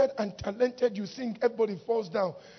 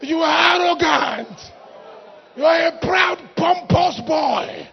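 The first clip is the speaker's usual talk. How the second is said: loud, high, drawn-out scolding; shouted rebuke; 6 semitones higher, 10 dB louder, slower than usual. Acted. Preacher denouncing a conceited singer in church, shouted rebuke. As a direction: thunder it out, stretching each insult.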